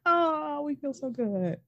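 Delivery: high, soft sappy group-hug voice; mock gushing. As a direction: coo it with exaggerated sentiment. Voicing the cheesy group hug.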